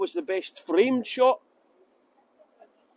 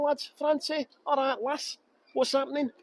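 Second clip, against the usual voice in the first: higher-pitched put-on Yorkshire accent; 4 semitones higher, 3 dB quieter than usual. Shown as a demonstration. Scottish guide showing viewers he can speak Yorkshire, Yorkshire accent. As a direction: put on Yorkshire as a party piece. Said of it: "I can speak Yorkshire as".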